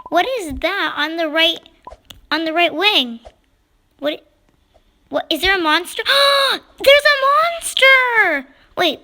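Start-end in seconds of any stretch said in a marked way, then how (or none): 6.0-8.5 high, loud, drawn-out cry of fright; frightened cry. Real, not acted. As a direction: shout it as the monster appears. Monster on the wing.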